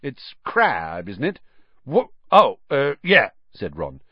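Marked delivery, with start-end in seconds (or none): 0.0-1.4 high, prim, offended prefect; stiff and haughty. A narrator's reading. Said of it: "he said stiffly". 1.9-3.3 startled, higher and louder stammer; flustered. A narrator's reading. Ron caught off guard.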